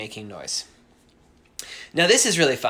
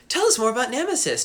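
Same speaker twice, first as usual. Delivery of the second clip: imitating eager commenters; 9 semitones higher, faster than usual. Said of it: "There's always like".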